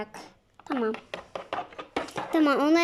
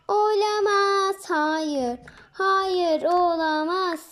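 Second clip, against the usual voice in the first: mock dismay; 5 semitones higher, much slower than usual. Miniş hears more will be eaten.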